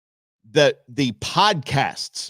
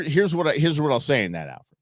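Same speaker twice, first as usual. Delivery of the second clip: usual speaking voice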